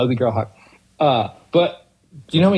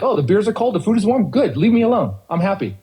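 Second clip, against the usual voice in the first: higher, content customer voice; a happy bar guest who wants to be left alone; easygoing guy customer; 6 semitones higher than usual. Retold or imitated. Bartender voicing a satisfied male customer, lightly and higher.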